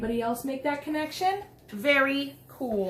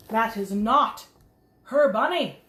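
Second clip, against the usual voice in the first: "not" pushed up, louder; stressing not; 3 semitones lower, 5 dB louder, slower than usual. Acted. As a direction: lean on "not" with a rising tone. Reader echoes Trixie's protest.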